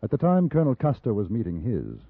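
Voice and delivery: low, measured storyteller's narration; story narration. A narrator's reading. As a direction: recount it like a memoir.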